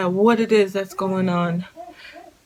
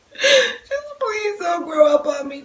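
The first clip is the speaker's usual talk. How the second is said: high, slow, half-sobbing plea; crying plea; 12 semitones higher, slower than usual. Retold or imitated. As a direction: let the voice crack as you beg. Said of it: "I was like".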